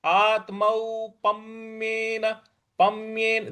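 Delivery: slow syllable-by-syllable आत्मौपम्येन with stress on पम्येन; Sanskrit recitation. Shown as a demonstration. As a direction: spell out आत्मौपम्येन slowly, हल्का आघात आधे म पर. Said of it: "इस प्रकार से बोलेंगे". (धीमे उच्चारण में आत्मौपम्येन)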